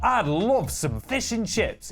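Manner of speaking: hammed-up, drawn-out delight; very enthusiastic. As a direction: savour every word like an overkeen customer. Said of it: "he's very enthusiastic".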